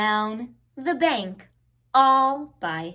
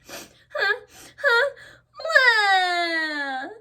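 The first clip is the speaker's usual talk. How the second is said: high hammed-up hiccuping sobs; fake-cry huh huh wah; playful pretend wail; mock sobbing; 8 semitones higher, much slower than usual. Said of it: "said Little Beaver".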